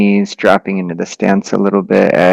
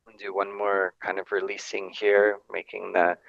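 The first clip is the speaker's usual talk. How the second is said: much softer guided voice; 14 dB quieter than usual. Real, not acted. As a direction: drop almost to a murmur. Very soft guided instruction.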